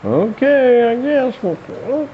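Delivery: very high put-on female voice; squeaky, hesitant mock consent; reluctantly willing woman. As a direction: give in doubtfully in a falsetto. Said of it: "one woman was brave enough to go".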